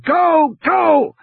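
high-pitched urgent yell; shouting. A narrator's reading. Muldoon runs for the jeep from the Tyrannosaur, shouting.

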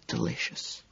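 whispered through a mouthful; mouth full. A narrator's reading. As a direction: mumble it past the fish. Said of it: "my mouth still full".

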